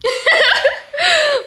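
bright burst of laughter; high laugh. Real, not acted. Laughing at height joke.

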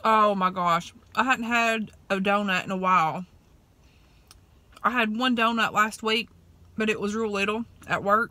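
speaking between bites; talking while chewing. Real, not acted. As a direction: mouth partly full of donut. Seen 0: Bit donut at -4s; lips pursed, chewing between words while holding piece.

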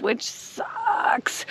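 whispered disappointment under the breath; a quick hushed grumble; muttered aside. Real, not acted. Grumbling to the camera about dud heaters, hushed.

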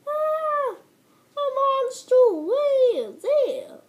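high, loud, terrified shout; panicked cry. Acted. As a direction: scream out the warning in panic. Villagers panic at monster.

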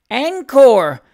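high, loud cry of Encore!; eager cry. A narrator's reading. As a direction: call out Encore! with delight. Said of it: "cried Miss Jemima Ivins's friend".

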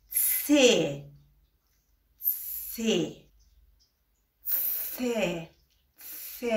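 س as a hissed voiceless tse sound, repeated; voiceless letter drill; German C sound demo. Shown as a demonstration. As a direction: press the tongue to the teeth and hiss. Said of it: "یکی از حروفه که یه ذره مشکل دارن".

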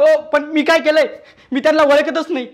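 re-enacted cry of innocence; pained protest. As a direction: wail in protest as the blows land. Acts out protest while being beaten.

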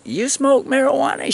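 high, bright retold question; mock curious question. Asking the lady if she smokes, in a high mock innocent voice. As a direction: ask it all innocent.

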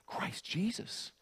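a breathless, awed whisper. Real, not acted. Preacher whispers the name to make it jump off the page, in a whisper. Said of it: "it ought to jump off the page".

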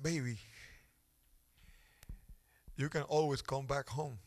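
soft, low comforting father; tender reassurance. Retold or imitated. A father holding his cold son close, soft and tender. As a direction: hush it gently to a cold child.

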